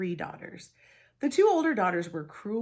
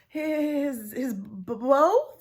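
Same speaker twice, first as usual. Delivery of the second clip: high, loud, flustered stammer; stammering surprise; 7 semitones higher, 7 dB louder, much slower than usual. Acted. Sisters caught off guard by the question, stammering. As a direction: trip over the word, caught out. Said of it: "they stammered in surprise".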